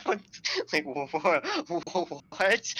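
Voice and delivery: high, loud, stuttered double take through laughter; startled game-alert reaction. Retold or imitated. Streamer acting out his shock at what he read, stammering and laughing. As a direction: sputter "what" like a startled video-game guard. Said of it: "someone noticing Snake in Metal Gear Solid One noise".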